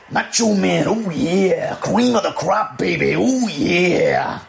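slow, high raspy promo voice; Macho Man impression. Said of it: "My Macho Man's not very good, but I'm gonna give it a go".

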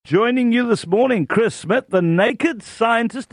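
bright radio announcer intro; upbeat presenter introduction; radio host intro. Acted. Host introduces guest on air.

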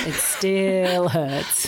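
very slow, drawn-out "still"; lingering lament. Real, not acted. Fan recalling the missed wicket, slow mock-pained lament. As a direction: stretch out STILL as if the wound is open.

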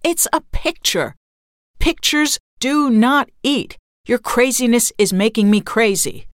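high, loud outburst, slowing for emphasis; worked-up husband losing his cool; exasperated shout. A narrator's reading. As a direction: shout each word as if fed up. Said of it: "he shouted".

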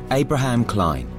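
plain reading voice

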